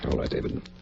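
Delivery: barely breathed agreement; whispered consent. Acted. Friend agreeing to stay with the surgeon, whispered. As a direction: give in under your breath.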